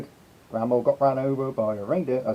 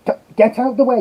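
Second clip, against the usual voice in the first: higher, louder get out of the way; impatient shout; 9 semitones higher, 5 dB louder, faster than usual. Real, not acted. Streamer yelling at the reindeer to clear off. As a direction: chase him off with your voice.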